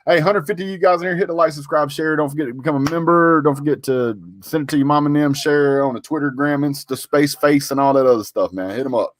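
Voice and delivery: high, bright call to subscribe; a quick hyped plea to like and share; hype shout-out. Acted. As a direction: pump up the viewers. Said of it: "shout-out to viewers".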